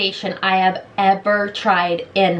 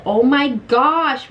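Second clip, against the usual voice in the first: usual speaking voice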